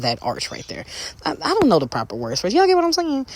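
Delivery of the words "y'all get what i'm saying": high sassy aside. Pretend.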